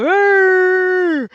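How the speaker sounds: a high, loud imitated dragon rumble crying along; dragon growl. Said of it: "I hope y'all like my sound effects".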